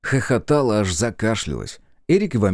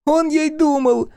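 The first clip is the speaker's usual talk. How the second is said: very high, louder, drawn-out cry; cheerful shout; 5 dB louder, slower than usual. A narrator's reading. Small friend caps the joke, cheerful shout. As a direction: yell it gleefully at the top of the range. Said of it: "весело крикнул Стрекотун".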